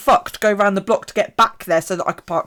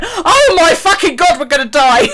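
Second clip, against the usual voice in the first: mock panic; 7 semitones higher, 7 dB louder than usual. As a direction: imitate a panicking passenger, nearly laughing. Friend's panic acted out, laughing.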